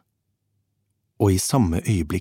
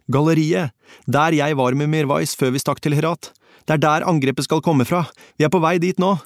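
high-pitched, urgent raised voice; shouting into phone; 7 semitones higher than usual. A narrator's reading. Narrator tells Ivana they are on their way, urgent shout. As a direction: keep calling urgently down the phone. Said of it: "ropte jeg inn i røret".

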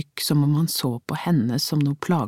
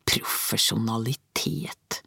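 slow, lower, softer sneering Profesjonalitet; scornful echo; 4 semitones lower, 5 dB quieter, slower than usual. A narrator's reading. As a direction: snort the word Profesjonalitet back at him. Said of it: "Hun fnyste".

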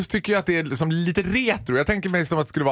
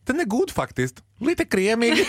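high, giggly imitation; imitating the Joggi lady; 7 semitones higher, slower than usual. Describing the ad woman he adores, high giggly mimicry. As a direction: put on her voice. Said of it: "i joggreklamen som säger".

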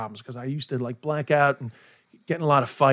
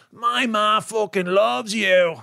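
slow, higher, broad Irish voice of a fan; imitating Irish fan; 5 semitones higher, much slower than usual. Fan tells the comedian her ma loves him, in a slow Irish brogue. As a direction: slowly drag out the fan's Irish brogue. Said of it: "They're like".

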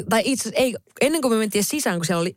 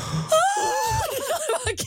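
very high, long-held squeal; half-laughing, crying-like high shriek; imitated squeal. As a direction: squeal thinly, re-enacting your own reaction. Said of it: "mä olin tälleen".

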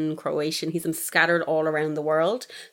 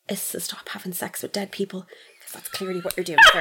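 mock whisper. Scolding Armie Hammer mock-whisper.

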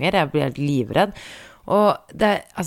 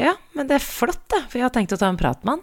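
sarcastic; 9 semitones higher than usual. Retold or imitated. Sarcastic thought about driver.

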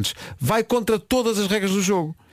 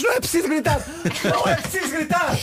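mock yell, much higher; shouting; 10 semitones higher than usual. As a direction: yell it like the fed-up inventor. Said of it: "Ele aos gritos". (grito exasperado a brincar)

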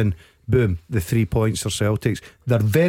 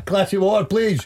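mock request; 8 semitones higher than usual. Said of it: "Have a seat".

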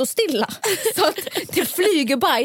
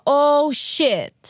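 startled curse; 5 semitones higher, 4 dB louder than usual. Retold or imitated. Realizing the dog must poop.

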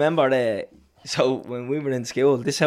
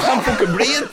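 laughing mock gasp; 8 semitones higher than usual. Retold victim gasping, laughing.

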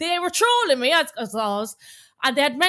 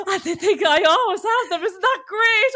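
imitating mom's delight; 6 semitones higher than usual. Retelling her mom's giddy reaction to trolls. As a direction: act out mom thrilled by the trolls. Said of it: "she's like".

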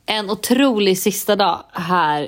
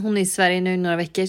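normal talking voice

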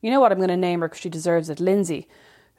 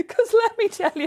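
laughing; 10 semitones higher than usual. Real, not acted. Laughing at own story.

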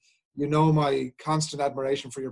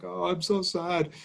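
put-on mopey child; 5 semitones higher, much slower than usual. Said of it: "he'll say stuff like".

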